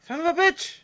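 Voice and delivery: high, loud frustrated curse; angry outburst. Real, not acted. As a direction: blurt it out in annoyance.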